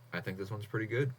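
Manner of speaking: ordinary talk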